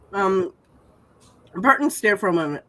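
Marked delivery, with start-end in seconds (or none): none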